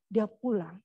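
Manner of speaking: ordinary talk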